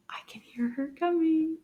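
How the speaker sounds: hushed whisper. Acted. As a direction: whisper it like a secret. Teacher whispers as puppet approaches.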